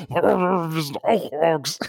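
slow put-on orc voice; disguised voice. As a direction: drag it out in a disguised voice. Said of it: "T O D mit verstellter Stimme".

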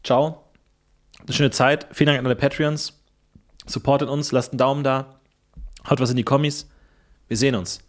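mock farewell. Podcaster saying goodbye before leaving the body, low voice. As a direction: sign off like a fading ghost. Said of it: "[name], ganz kurz. Wir müssen uns noch verabschieden".

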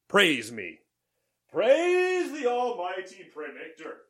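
mock godlike proclamation; grandiose. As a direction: boast like an almighty oracle. Mock self-worship after predictions.

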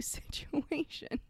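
giggling mid-sentence; laughing through words. Real, not acted. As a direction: breaks up laughing, slowed and soft.

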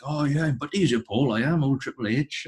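cheerful mock Valleys boast, voice lifted up; Welsh Valleys accent. Dad boasts to the wrestling fan in a Valleys accent. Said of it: "Welsh accent or, you know, a Valleys accent".

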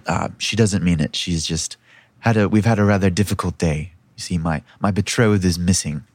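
apologetic princess, very low in pitch; low, polite smoothing-over; Myra. Acted. Princess apologising to a stranger for her sister, politely low-voiced.

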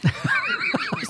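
high-pitched burst of laughter. Real, not acted. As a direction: laugh high at your own punchline. Laughs at her own punchline.

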